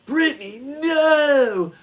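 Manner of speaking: high loud anguished yell; hammed-up shout of despair; dismayed cry. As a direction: cry out in mock horror. Friend lost her ticket.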